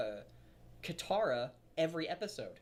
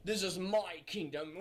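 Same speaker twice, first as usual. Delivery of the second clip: tyrant; 2 semitones higher, slower than usual. Retold or imitated. Tyrant quote voiced in story.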